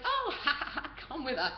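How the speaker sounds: high, loud imitation chuckle into speech; mock laughing. Laughing guards leading tourists out, mock amusement. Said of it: "they start laughing at us".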